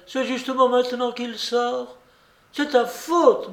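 high-pitched reproach; old lady crying out. A narrator's reading. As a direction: blame them in a high, upset voice. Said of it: "cria-t-elle". (reproche indigné, voix aiguë)

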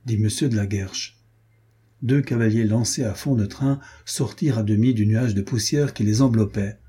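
ordinary delivery

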